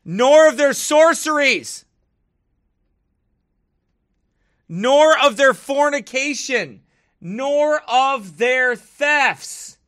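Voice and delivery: high, slow, loud scripture reading; verse proclaimed. Acted. Reading viewers the verse as proof, loud proclaiming. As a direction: proclaim it like a mock preacher.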